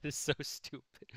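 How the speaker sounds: real laugh through the words. Real, not acted. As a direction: crack up at your own silly example. Amused by own silly example.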